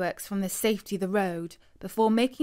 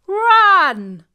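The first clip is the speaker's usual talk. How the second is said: very loud, high scream; panicked shout; 11 semitones higher, 14 dB louder than usual. A narrator's reading. Uncle screams at everyone to flee, very loud and high. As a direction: scream it to get everyone moving. Fleeing from bull.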